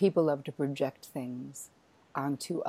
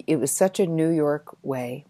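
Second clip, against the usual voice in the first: ordinary talk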